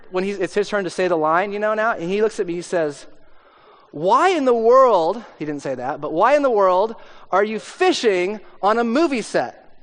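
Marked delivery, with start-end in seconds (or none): 3.9-5.2 loud, slow, high incredulous question. Retold or imitated. Scene partner questioning a bad improv choice, incredulous. 6.1-9.8 higher-pitched mock disbelief; incredulous. Partner asking why he's fishing on set, incredulous.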